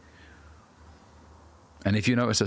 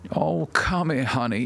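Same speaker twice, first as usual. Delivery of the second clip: high, cooing mock sympathy; mock coddling; 6 semitones higher, slower than usual. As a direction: coddle them in a raised, put-on voice. Mimics someone coddling the self-pitier.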